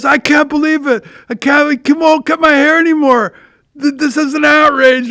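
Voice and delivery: high-pitched indignant protest; retold upset. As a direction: imitate a friend worked up and outraged. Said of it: "And he was so upset".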